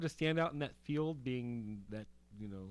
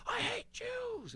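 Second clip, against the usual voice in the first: mock movie bigot; much slower than usual. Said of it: "somebody who was like".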